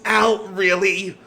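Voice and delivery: high, loud, drawn-out yelp of complaint; pained protest. Acted. Captive protests to the one holding him, pained and loud. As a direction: cry out indignantly at being hurt.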